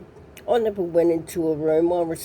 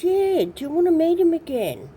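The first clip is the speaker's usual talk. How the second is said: high quote; 11 semitones higher, faster than usual. Retold or imitated. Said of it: "She goes".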